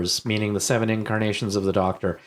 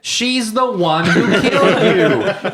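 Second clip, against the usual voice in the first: loud, high, drawn-out protest; incredulous outcry; 10 semitones higher, 8 dB louder, much slower than usual. Real, not acted. Host scolding the Doctor about Grace, incredulous outcry. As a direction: wail the objection slowly, half amused. Loudly objecting to the absurd plot.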